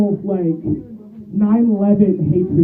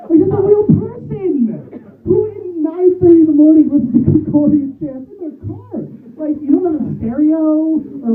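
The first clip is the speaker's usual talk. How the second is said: higher, exasperated disbelief; a comic ranting about a neighbour's car; incredulous rant; 9 semitones higher than usual. Real, not acted. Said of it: "ranting in disbelief".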